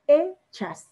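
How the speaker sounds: Hechas in two beats, loud E then chas; teacher sounding out E... chas in Hechas; stress on E in Hechas. Shown as a demonstration. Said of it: "la vocal fuerte".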